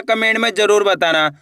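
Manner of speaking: ordinary delivery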